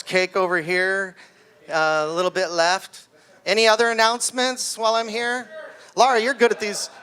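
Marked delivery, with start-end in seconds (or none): none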